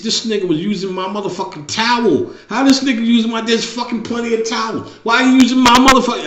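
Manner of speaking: high, loud indignant rant; outraged son. Retold or imitated. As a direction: rant about the towel, fed up. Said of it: "He said".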